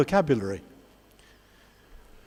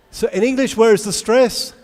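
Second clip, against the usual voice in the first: ordinary talk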